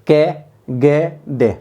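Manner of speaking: कै, गै, डे drawn out slowly, one by one; spelled-out consonants. Shown as a demonstration. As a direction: stretch each consonant कै, गै, डे very slowly. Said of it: "अपने आप उच्चारण कर लीजिए".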